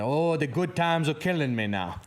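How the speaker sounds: bad Cajun accent. Northern singer imitating Cajun friend in a bad Cajun accent. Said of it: "that's a Yankee Boys version of a Cajun accent".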